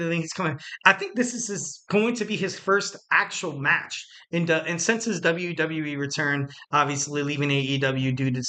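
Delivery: ordinary talk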